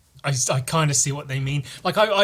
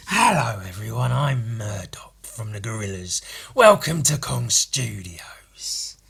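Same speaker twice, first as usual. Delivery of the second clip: Murdoch. Acted. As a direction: stretch the words in a whispery rasp. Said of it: "That's a bit more like Murdoch".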